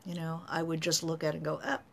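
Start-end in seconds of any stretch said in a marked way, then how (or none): none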